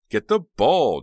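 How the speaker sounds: loud high scream. Acted. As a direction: yell it at the players. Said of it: "Jade screamed".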